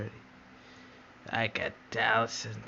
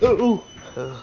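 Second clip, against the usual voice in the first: excited; 10 semitones higher, 11 dB louder than usual. Pretend. Excited exclaim in play.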